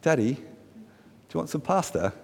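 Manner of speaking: a hint of a small child asking Daddy; young daughter. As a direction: gently mimic a daughter's sweet offer. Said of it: "she says".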